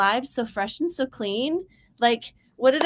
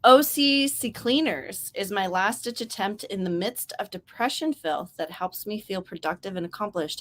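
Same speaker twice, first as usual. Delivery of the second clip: usual speaking voice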